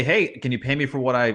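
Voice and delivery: ordinary talk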